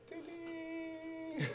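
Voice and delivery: high, drawn-out "ding, ding"; imitated bell chime. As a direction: sing out a little bell ding. Voices a bell after announcing topic.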